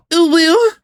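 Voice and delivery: high, sugary, teary fake-sweet voice; mock a tearful cutesy streamer; fake cutesy girl voice. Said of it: "doing the ... bit".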